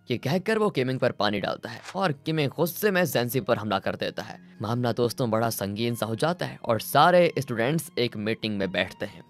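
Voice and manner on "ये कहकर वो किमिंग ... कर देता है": ordinary delivery, nothing marked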